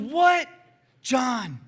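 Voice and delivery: high, loud cry of realisation; shouted exclamation. Retold or imitated. Friend struck by what happened, shouting. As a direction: let it burst out at full volume.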